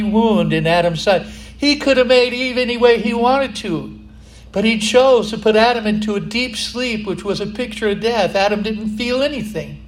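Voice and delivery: loud, high fervent preaching; rising fervent sermon delivery; preaching at full force. Acted. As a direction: preach it out to the whole church.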